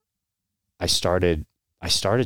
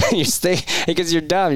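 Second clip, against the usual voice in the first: laughing; 10 semitones higher, 4 dB louder than usual. Real, not acted. Laughs at his younger self.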